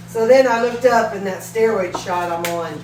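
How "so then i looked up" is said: ordinary talk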